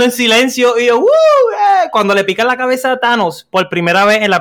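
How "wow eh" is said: excited cheer. Retold or imitated. Retelling lone cheer in silence.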